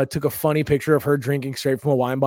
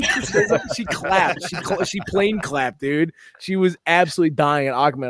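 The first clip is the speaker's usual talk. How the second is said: ordinary talk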